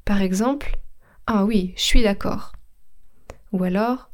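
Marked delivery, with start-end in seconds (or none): 1.8-2.5 „je suis” said as „shwee”; potoczne ściągnięcie. Shown as a demonstration. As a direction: merge „je suis” into „chui”. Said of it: "son je qui devient che".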